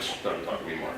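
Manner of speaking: soft, low mock shushing; dismissive hush. Poet waving off a weak palindrome, hushed mock dismissal.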